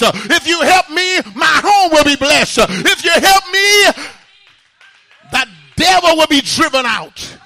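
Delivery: loud, rhythmic sermon delivery with uh breaths; fiery preaching. Acted. Preacher urging the church to call on God, fiery preaching. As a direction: preach it hard to the congregation.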